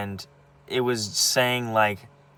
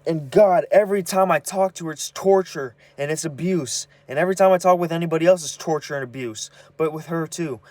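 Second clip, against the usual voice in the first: real pain breaking through, rising and louder; upset, close to tears; 7 semitones higher, 5 dB louder than usual. Real, not acted. Venting to camera about her mom, upset and near tears. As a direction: voice pitched up, strained with hurt.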